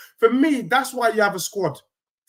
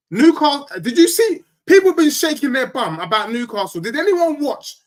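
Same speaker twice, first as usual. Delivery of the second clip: louder, higher burst about Newcastle; louder, higher excited Newcastle; 7 semitones higher, 5 dB louder than usual. Real, not acted. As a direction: jump in hyped about the game.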